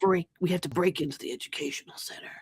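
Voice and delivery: soft, conspiratorial whisper; sly. Acted. Player's character confiding a break-in plan to the owl, sly whisper. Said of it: "trying to be all sly".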